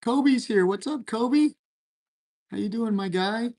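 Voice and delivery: very high, playful hello; excited greeting. Real, not acted. Streamer welcoming Kobe into the chat, excited greeting.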